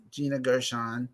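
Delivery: normal talking voice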